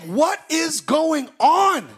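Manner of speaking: high, slow, loud bewildered cry; a worshipper overcome in a church service; stunned shout. Retold or imitated. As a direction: blurt it out, overwhelmed. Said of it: "and you're like".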